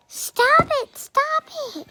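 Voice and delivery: very high squealed protest; mock pleading. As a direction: squeal it like a crowd imitated. Retold crowd protesting amid laughter.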